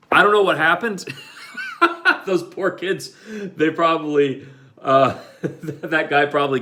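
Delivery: laughing. Real, not acted. Narrator laughing at the kids' bad luck, laughing. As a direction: crack up telling it.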